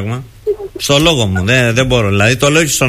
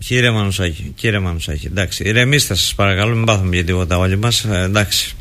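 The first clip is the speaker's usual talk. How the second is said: softer, lower, calming voice; soothing; 6 semitones lower, 5 dB quieter than usual. Real, not acted. Host begging the upset striker to calm down, soothing. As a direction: gently talk him down.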